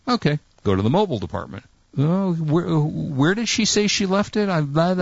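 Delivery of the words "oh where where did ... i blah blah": mock-puzzled clerk, higher and slow; lightly voiced clueless clerk searching. Mimicking the Best Buy clerk, puzzled and slow.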